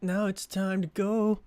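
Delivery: high, slow put-on voice; slow and high-pitched; high drawn-out retake. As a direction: stretch it out, pitched well up. Line redone in altered voice.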